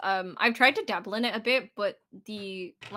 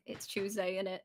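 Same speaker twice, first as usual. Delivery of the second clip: softer natural British delivery; British accent; 2 semitones lower, 10 dB quieter than usual. Shown as a demonstration. Brit correcting a friend's attempt, natural British accent. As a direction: show the proper British way, softly. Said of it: "It's wrong".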